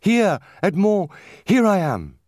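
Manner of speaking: high, loud answering call; young girl calling out eagerly to her lover; exclaiming. A narrator's reading. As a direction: cry it out high and ringing. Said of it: "exclaimed the young girl".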